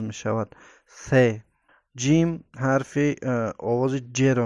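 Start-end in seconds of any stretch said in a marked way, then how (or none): 0.9-1.1 "س" said as th, tongue between the teeth; lisped th sound on "س"; interdental TH. Shown as a demonstration. Teacher showing learners the Arabic letter, interdental "س". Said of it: "زبان بین دو دندان تلفظ".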